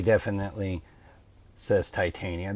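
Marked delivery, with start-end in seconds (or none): none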